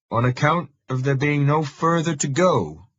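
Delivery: put-on a gruff rural voice with a familiar local accent. A narrator's reading. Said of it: "he says in a familiar accent".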